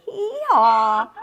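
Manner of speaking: loud laughing hee-haw; loud braying donkey. Shown as a demonstration. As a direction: bray it out like a donkey. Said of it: "Donkeys say".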